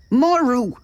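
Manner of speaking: Maru high and falling, a cried-out answer; shouted. A narrator's reading. Exasperated man shouts "Maru" as his answer. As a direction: cry out "Maru" high, dropping at the end. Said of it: "he shouted".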